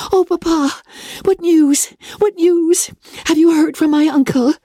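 high, breathless eagerness; eager cry. A narrator's reading. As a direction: cry out to papa, urgent and high-pitched. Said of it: "panting for breath, came up with him and eagerly cried out".

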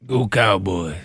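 low Coach O impression. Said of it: "And my Coach O voice".

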